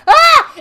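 short, very high loud shriek; mock scream. Venomar as the spiders show up, high mock scream. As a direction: yelp out a hammed-up scream.

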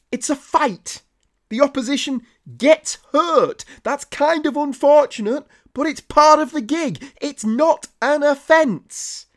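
high-pitched indignant rant; indignant protest. Acted. Comedian defending the wrestler who hit too hard, indignant. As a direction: argue it in a high, outraged voice.

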